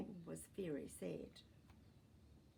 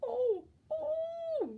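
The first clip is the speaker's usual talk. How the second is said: put-on high, loud child's yell; shrieking "Oh, oh!" of a small boy; 9 dB louder than usual. A narrator's reading. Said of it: "yelled the little boy".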